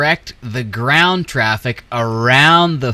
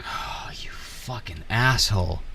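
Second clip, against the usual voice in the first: hissed under the breath, no voice; real anger whispered to himself; a furious aside, whispered; whispered curse; 6 dB quieter than usual. Real, not acted. Cursing under breath at game.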